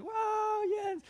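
high, whooping cheer; cheering crowd. Retold or imitated. Fans on the mountain cheering Jens, in a high excited whoop.

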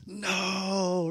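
high, drawn-out mock dismay; shocked listeners. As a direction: gasp it out like a stunned audience. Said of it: "They're like".

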